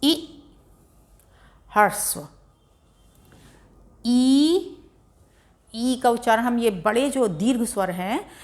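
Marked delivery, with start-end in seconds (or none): 4.0-4.8 ई stretched out, higher; teacher holding ई to show a long vowel. Shown as a demonstration. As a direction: pull the ई out long. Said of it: "उच्चारण खींच के होता है".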